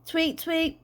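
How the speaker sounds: high-pitched imitated birdsong; Dad pretending to be a chirping bird; bird tweeting. A narrator's reading. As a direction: tweet like a little bird, in pairs. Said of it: "He went".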